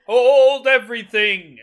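loud, drawn-out call to halt; hammed-up, slow, loud announcement; mock proclamation. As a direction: call it out slowly like a herald. Quotes the film's announcing line.

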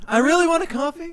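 high, loud, whiny coffee craving; Jerry Seinfeld. Retold or imitated. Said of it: "Jerry Seinfeld just being like".

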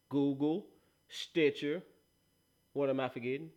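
ordinary delivery

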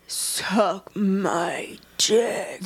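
slow, breathy mock whisper; mock sultry whisper. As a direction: purr it like a romance hero. Mocking Hook's voice.

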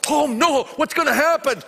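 loud, high, hammed-up fretting; mock panic. Preacher imitating people freaking out, mock alarm. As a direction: wail it like a worrier, half-joking.

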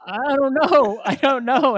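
loud, high-pitched helpless whine, played for laughs; exasperated protest. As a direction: act out a flustered kid cornered by mom. Son protesting to questioning mom.

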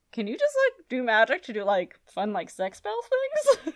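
lightly laughing mock teen plea. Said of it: "like, we would have said".

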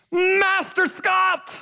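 put-on high, drawn-out kid's call; imitated enthusiastic shout. Boy running up to his kids pastor, high stretched-out mock shout. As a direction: mimic a boy hollering with glee. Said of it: "he shouted at me".

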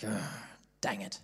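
low, soft "ugh, dang it" under the breath; a grumbling sigh to himself; muttered groan. Retold or imitated. Student dreading his turn, low grumble.

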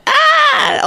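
piercing, high squealed ah. Real, not acted. Host breaking off her own voice demo, high squeal. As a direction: let out a sudden high shriek.

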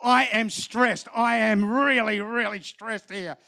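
slow, drawn-out, emphatic complaint; acted-out venting to mother; stressed confession. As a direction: stretch each word, fading at the end. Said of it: "I just start telling my mother".

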